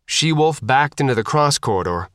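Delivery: ordinary delivery, nothing marked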